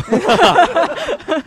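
a burst of high, loud laughter. Real, not acted. Laughing hard at her own joke.

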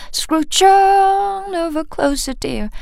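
"Scrooge on" lifted high and slow; pulled-up pitch. Shown as a demonstration. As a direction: stretch the tune upward on "on". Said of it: "这里往上拉音". (夸张地往上拉音)

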